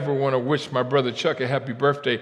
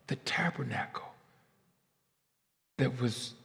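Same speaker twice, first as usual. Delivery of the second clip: hushed reverent whisper; 9 dB quieter than usual. Real, not acted. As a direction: breathe it out in awe. Hushed reverent show and tell.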